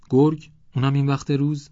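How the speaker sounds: high, loud disbelieving question; incredulous surprise. A narrator's reading. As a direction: balk at an unbelievable claim. Visitor doubts a wolf came. (با تعجب و ناباوری)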